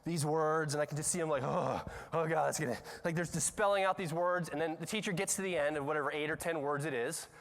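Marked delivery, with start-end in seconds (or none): 1.4-2.8 mock dread. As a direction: groan like a kid dreading the next word. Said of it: "the sweat starts building up".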